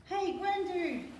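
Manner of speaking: high, slow call. Acted. Child calling out.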